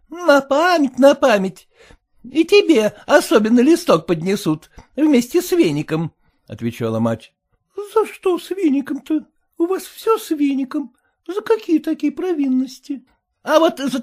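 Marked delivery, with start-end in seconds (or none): 0.1-6.1 high, sour, fretful wife voice; high complaining wife; sour merchant's wife. A narrator's reading. Mother voiced. 7.9-13.1 put-on high, tearful girlish whine; high aggrieved daughter; whining daughter. A narrator's reading.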